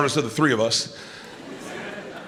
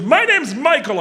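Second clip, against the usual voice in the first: frantic dad; 9 semitones higher than usual. Retold or imitated. Frantic dad introduces himself, retold.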